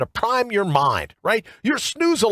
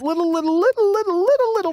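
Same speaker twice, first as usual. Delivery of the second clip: rising little; 13 semitones higher than usual. Acted. Stretched repeated little, rising.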